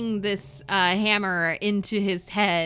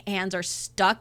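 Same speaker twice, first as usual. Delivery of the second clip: ordinary delivery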